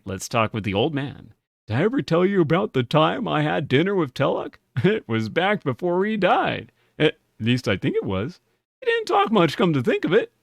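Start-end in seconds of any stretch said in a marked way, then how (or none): 1.7-10.3 put-on a creaky old man telling a tale; lightly voiced old storyteller. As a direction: ramble like an old barfly. Reading old man's dialogue aloud.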